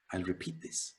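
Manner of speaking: ordinary delivery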